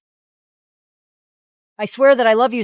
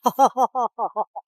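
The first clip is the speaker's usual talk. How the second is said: scornful laugh; 4 dB quieter than usual. Acted. Man called a bastard by his boss, scornful laugh. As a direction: laugh off the insult.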